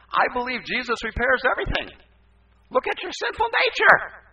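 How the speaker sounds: loud, high-pitched pulpit shout; fiery preacher's cry; full-force preaching. Acted. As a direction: proclaim it at the top of your voice. Preaches his old counsel at full force.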